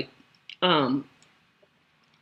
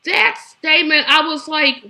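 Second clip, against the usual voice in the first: ordinary talk, nothing marked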